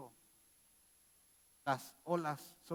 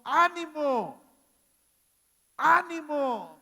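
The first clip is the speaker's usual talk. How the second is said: very loud, much higher, the second one slow and stretched; shouted encouragement; 11 semitones higher, 15 dB louder, much slower than usual. Acted. Jesus shouting courage to the disciples in the storm, full-throated shout. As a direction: bellow it over the roaring sea. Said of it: "pero también les gritó".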